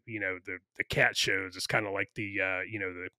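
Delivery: ordinary talk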